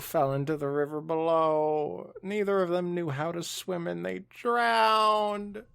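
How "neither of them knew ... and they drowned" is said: high, slow, drawn-out grieving; mock tragic wail; dramatic lament. Dramatic reveal of deaths.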